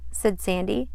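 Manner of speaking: plain reading voice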